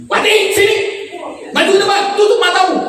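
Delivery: high, brusque order to the wife; mock angry husband. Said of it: "Sama laki kita begitu juga". (tiruan suami galak bernada tinggi)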